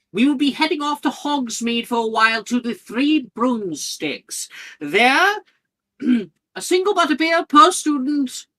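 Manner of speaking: a tiny professor soothing hungry students; Flitwick voice. Acted. Flitwick continues, GM voice.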